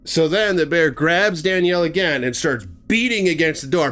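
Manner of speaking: usual speaking voice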